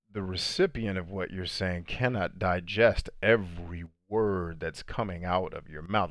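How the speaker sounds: slow, measured, each word given room; deliberate slow cadence. Shown as a demonstration. Speaker showing viewers his practiced cadence, slow and measured. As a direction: let every word land slowly. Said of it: "you see how I did that kind of cadence right there".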